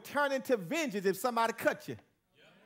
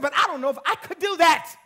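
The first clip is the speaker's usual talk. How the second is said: quoting the evangelist; 9 semitones higher, 10 dB louder than usual. Retold or imitated. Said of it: "She said".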